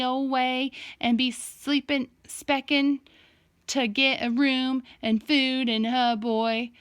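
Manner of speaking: put-on a father clowning in a thick Southern hillbilly accent; hillbilly accent imitation. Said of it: "Dad did an imitation of a hillbilly accent".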